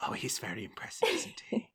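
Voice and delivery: fast breathy whisper; old woman whisper. Acted. Cecily gossiping to her husband, fast whisper. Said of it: "And Cecily says".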